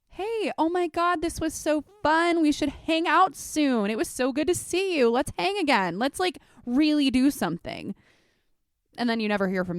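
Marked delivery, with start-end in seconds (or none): 0.1-7.9 much higher, louder, slowed gushing; fake gushing friend. Imitating a friend who says let's hang out, bubbly and high. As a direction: gush with over-the-top fake enthusiasm. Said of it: "as simple as the whole".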